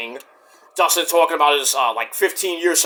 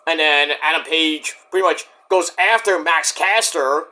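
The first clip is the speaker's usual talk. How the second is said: ordinary talk, nothing marked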